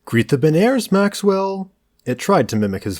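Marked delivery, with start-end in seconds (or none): 0.0-1.7 high, loud call to a dog; landlord's command. A narrator's reading. Landlord showing off his talking collie, commanding. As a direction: order the dog, pitch climbing high. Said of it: "When the landlord said".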